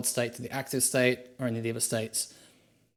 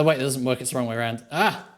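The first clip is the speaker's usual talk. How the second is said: ordinary delivery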